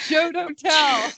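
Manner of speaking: slow, laughing writing-teacher line; mock teacher critique. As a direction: drawl it like a smug teacher. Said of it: "critiquing like a teacher".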